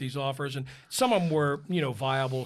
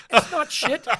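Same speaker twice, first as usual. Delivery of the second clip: louder, higher protest; insistent denial; 12 semitones higher, 8 dB louder than usual. Retold or imitated. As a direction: push back hard, half laughing. Insisting to husband.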